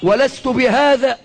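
measured, drawn-out classical Arabic address; rallying speech from the pulpit, each phrase set apart; formal oratory. Acted. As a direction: proclaim it to the nation in stately phrases.

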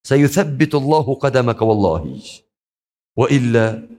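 usual speaking voice

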